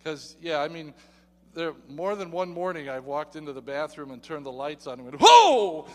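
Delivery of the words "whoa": loud, high shocked gasp of a word; a sudden, loud, high "whoa"; startled whoa. Retold or imitated. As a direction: jump back from the mirror. Startled by the mirror.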